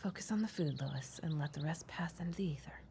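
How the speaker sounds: 囁き声の内緒話; 客に聞こえないよう小声で囁く; whispered aside. Acted. Aside whispered to Lois in store.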